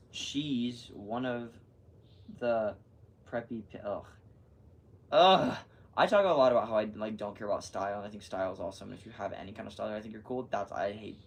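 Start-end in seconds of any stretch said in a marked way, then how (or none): 5.1-5.6 a sharp, loud ugh of disgust; loud disgusted groan. Real, not acted. Disgust at preppy girl.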